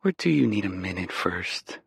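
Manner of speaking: sultry voice. Acted. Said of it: "Rachel says in that sultry voice".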